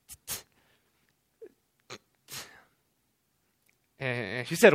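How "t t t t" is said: stuttering block. Retold or imitated. Stuttering block at ordering window.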